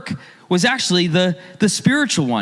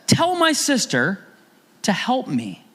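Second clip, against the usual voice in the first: frustrated demand; 6 semitones higher than usual. Retold or imitated. Martha complaining to Jesus about her sister, frustrated. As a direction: sound worn out and fed up with your sister. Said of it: "Can you hear her frustration?".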